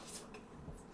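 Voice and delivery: ordinary delivery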